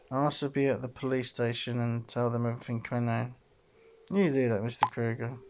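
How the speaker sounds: ordinary talk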